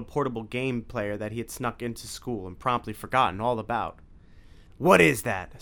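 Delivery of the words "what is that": loud alarmed snarl. A narrator's reading. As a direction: snap the question in alarm. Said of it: "snarled Dracula".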